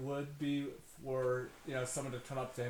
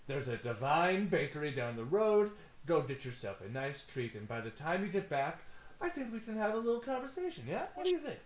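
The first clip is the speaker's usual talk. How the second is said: smooth-talk a business partner; fast-talking hustler; 3 semitones higher than usual. Acted. GM voices Tony.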